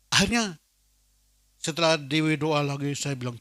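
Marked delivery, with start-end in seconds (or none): none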